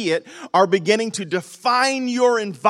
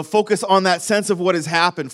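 ordinary talk

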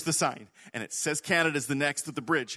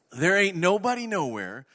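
normal talking voice

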